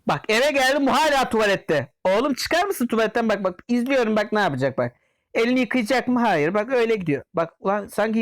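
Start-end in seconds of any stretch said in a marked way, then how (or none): none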